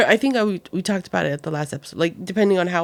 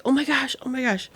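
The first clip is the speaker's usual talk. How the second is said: higher, softening pained gasp of words; mock pained; 5 semitones higher, 4 dB quieter than usual. Pregnant mom retelling a twinge, mock pained. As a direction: act out riding through a sudden cramp. Retold reaction to pregnancy pain.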